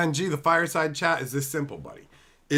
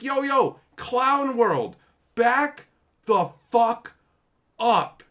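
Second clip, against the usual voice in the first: exasperated outburst; 10 semitones higher, 6 dB louder, much slower than usual. Real, not acted. Telling overheated fans off.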